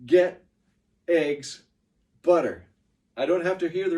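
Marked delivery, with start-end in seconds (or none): 0.1-2.6 GET, EGGS, BUTTER punched loud, one by one; isolated loud GET, EGGS, BUTTER; key words only. Shown as a demonstration. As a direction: hit each word hard with a pause between. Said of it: "What I need to hear is".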